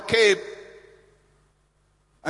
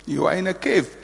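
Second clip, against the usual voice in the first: ordinary talk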